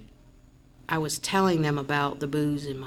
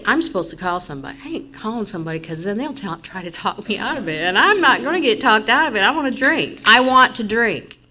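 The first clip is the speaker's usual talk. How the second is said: defiant inner voice; 5 semitones higher, 7 dB louder than usual. Retold or imitated. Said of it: "And I'm thinking".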